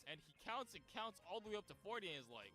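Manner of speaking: ordinary delivery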